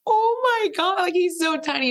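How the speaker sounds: very high, imitated overcome little girl; tearful awe. Daughter meeting her newborn brother, imitated high tearful awe. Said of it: "like, tears up".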